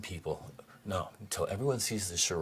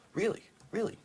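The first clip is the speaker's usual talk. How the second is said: much higher, louder sarcastic really; mock disbelief; 3 dB louder than usual. Real, not acted. Mocking Trump's projects to listeners, high mock disbelief. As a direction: act stunned.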